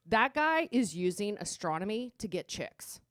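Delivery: usual speaking voice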